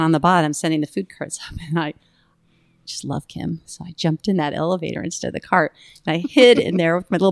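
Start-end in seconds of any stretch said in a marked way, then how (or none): none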